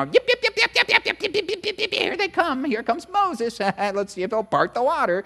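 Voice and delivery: very high yapping little-dog voice; yapping gossips. Retold or imitated. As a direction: yap like office gossips. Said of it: "picturing them all at the water cooler".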